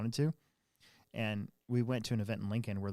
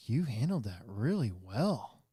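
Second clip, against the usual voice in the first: impressed coworker; slower than usual. Retold or imitated. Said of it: "He's like".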